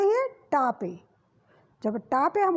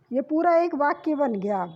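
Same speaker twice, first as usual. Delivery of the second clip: ordinary talk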